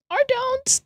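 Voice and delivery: very high, very soft squeak; squeaky retort. Acted. Streamer teasing a chatter, in a tiny squeak. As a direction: flip to a tiny mock voice.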